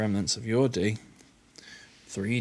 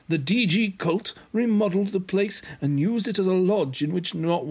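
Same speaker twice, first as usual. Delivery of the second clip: higher put-on character voice; raised-voice game exposition; Arya voice; 6 semitones higher, 4 dB louder than usual. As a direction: keep the character's raised pitch. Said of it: "Yes, we do that".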